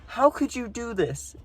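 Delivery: high, whiny mock girl voice; mock hurt girlfriend, high-pitched; imitating upset girl. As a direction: squeak out a hurt accusation. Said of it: "And she's like".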